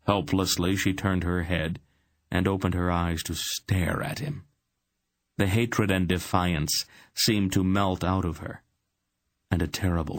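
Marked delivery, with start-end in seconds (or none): none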